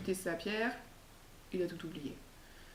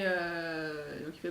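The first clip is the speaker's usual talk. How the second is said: usual speaking voice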